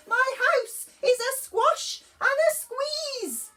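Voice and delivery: put-on high, loud despairing old lady cry; a granny tearing her hair out. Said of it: "The little old lady cried".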